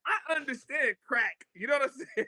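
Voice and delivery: high, laughing disbelief at selling rules; incredulous. Retold or imitated. To the crew claiming the blocks, high laughing disbelief. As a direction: say it like you can't believe it, half laughing.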